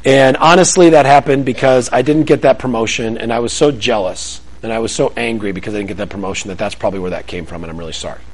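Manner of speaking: earnest apology to colleagues, speeding up at the end; confessing coworker. Acted. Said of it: "some guy says".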